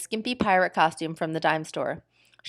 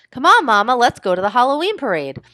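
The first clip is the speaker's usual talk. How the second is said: higher, louder eager plea; eager child; 5 semitones higher, 7 dB louder than usual. Acted. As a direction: pull her along excitedly. Eager child urges mother.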